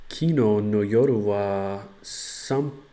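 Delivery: slow, careful learner Japanese; loud, halting Japanese practice; japanese learner accent. Shown as a demonstration. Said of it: "Let's try that".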